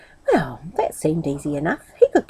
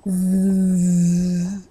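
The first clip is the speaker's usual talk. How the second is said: fly buzz. A narrator's reading. Fly buzzing voice.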